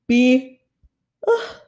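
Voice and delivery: ugh through a real yawn; a late-night yawn cutting into the word. Real, not acted. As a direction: let a tired yawn break in. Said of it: "I'm fucking yawning".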